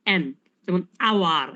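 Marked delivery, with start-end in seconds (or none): 1.1-1.5 আওয়ার begun on an open vowel, no H; silent H. Shown as a demonstration. Teacher giving the student an example, আওয়ার with silent H. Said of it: "এইচ উচ্চারণ কিন্তু হাওয়ার হয় না".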